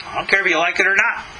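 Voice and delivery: ordinary talk, nothing marked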